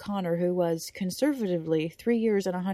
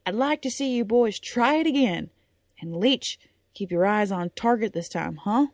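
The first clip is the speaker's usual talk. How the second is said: put-on louder, brisk order to the boys; commanding coach; 3 semitones higher, 6 dB louder than usual. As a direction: order them to go again. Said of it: "coach ordering boys".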